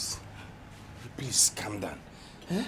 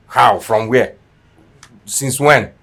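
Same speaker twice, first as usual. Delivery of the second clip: suspicious interrogation; 9 dB louder, much slower than usual. Acted. Suspicious spouse questions the friend.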